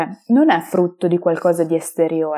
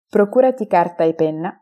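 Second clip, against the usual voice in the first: ordinary talk, nothing marked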